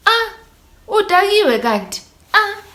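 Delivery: high mock scoff at a drunk tenant; landlady's scoff. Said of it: "Mastandi was like".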